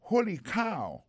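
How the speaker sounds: high amazed exclamation; high holy cow; surprised. Shown as a demonstration. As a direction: sound struck with surprise. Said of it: "c'est qu'on a dit".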